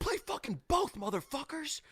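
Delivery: high, strained imitation of a furious yell; mock dad rage. Fed-up star orders band to play, mock raging yell. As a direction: imitate raging at the band without real volume. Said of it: "like, dad rage".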